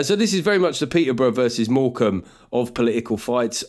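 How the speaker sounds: ordinary talk, nothing marked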